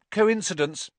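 high, breezy dismissal; airy. A narrator's reading. Girl pouring juice, dismissing the death, airily. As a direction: toss it off airily. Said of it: "said Hermione airily".

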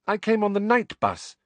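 high, airy announcement. A narrator's reading. Hermione announcing how she arrived, airily. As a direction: toss it off airily, pitched well up. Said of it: "said Hermione airily".

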